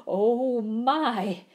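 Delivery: a loud startled oh my; loud startled gasp. A narrator's reading. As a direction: gasp at the bear. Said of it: "gasped Mister Winky".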